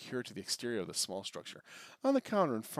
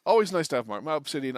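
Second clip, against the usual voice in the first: excited; 4 semitones higher, 9 dB louder than usual. Real, not acted. Thanks a viewer by name.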